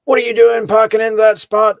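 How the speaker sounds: high, loud indignant yell; retelling an angry man confronting a parked car; angry shout. Retold or imitated. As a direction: shout it at the driver, outraged. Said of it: "Michael Draker parks up".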